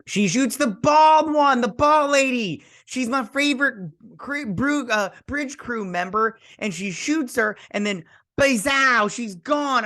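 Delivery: high-pitched wailing recap; comic outrage. Real, not acted. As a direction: squeal in distress over a favorite character. Mock outrage over favorite character's death.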